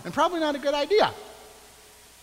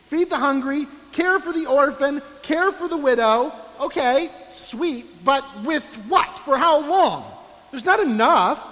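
scoffing skeptic; 6 semitones higher, 4 dB louder than usual. Retold or imitated. Skeptic objecting to teachings.

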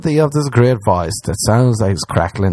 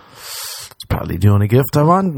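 usual speaking voice

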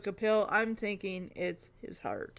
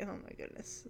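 usual speaking voice

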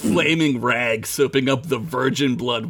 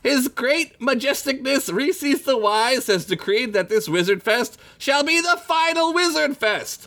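high, booming herald proclamation; grand announcer voice; 11 semitones higher than usual. Acted. Announcer proclaiming the final fest.

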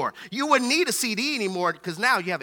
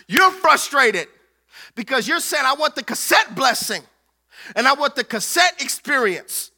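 higher and louder, half-sung preaching; preaching climax; 4 semitones higher, 3 dB louder than usual. Acted. Pastor rebuking comfort-seeking believers, half-sung preaching.

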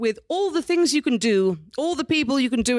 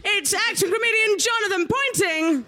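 announcer shout; 10 semitones higher than usual. Real, not acted. Host introducing guest to audience.

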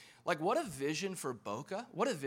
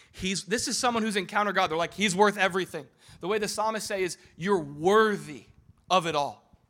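high, loud impassioned preaching; full-force preaching; 4 semitones higher, 10 dB louder than usual. Acted. Pastor preaching at full force to his church. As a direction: preach it out to the congregation.

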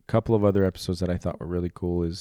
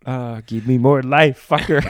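Roy Batty; 5 semitones higher, 9 dB louder than usual. Acted. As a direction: demand it like a dying android. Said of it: "I turned into Roy Batty".

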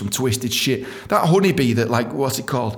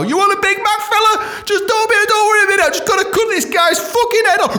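much higher, louder, manic cheery clown voice with a laugh; a chirpy Ronald McDonald, knife in hand; cheery killer clown; 5 dB louder than usual. Retold or imitated. Clown greeting customers while butchering a cow, high manic cheer.

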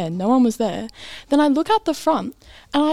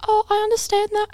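high-pitched, meek customer-service reply; placating staff voice; 10 semitones higher than usual. Retold or imitated. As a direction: soothe an angry customer, voice way up. Staffer placating the screaming mum.

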